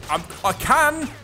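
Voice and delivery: high, loud, slowed cry of triumph; real excited yell; triumphant. Real, not acted. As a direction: crow over the win. Van gets into building.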